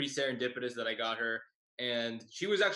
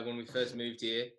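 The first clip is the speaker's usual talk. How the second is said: ordinary talk, nothing marked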